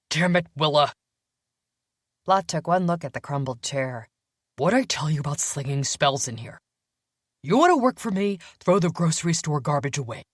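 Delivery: irritated employer scolding an assistant; stern, annoyed councilman; intimidating scowling boss. Acted. Voices the scolding boss Blott.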